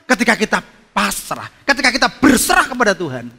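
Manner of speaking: forceful preaching. Real, not acted. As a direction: drive the point home loudly. (berkhotbah dengan suara lantang)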